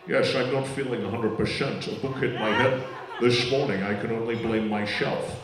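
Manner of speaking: low Scottish voice, S as sh; Sean Connery. Acted. Comic showing actor can't say S, low Scottish impression. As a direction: keep it low with slushy S sounds. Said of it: "he can't say the letter S".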